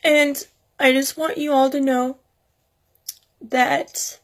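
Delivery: high, tearful voice breaking through the words; heartbroken, crying while speaking to viewers. Real, not acted. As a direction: talk through real tears, voice pinched high. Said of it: "I'm sorry for crying".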